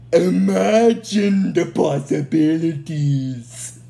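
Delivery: evil emperor relishing his new army; gloating Zed. Retold or imitated. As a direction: savor each word of his evil plan. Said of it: "Zed gets ecstatic about that".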